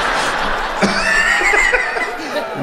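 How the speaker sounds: very high squealing imitation laugh; mock high laugh. Comic imitating the wife's shrieking laugh. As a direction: squeal it out like her. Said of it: "She's like".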